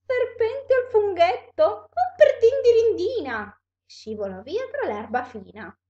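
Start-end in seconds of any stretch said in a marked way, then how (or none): 0.1-3.6 high, loud startled cry; alarmed. Acted. Snake hears it may be cooked, alarmed. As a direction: exclaim in a high-pitched storybook panic.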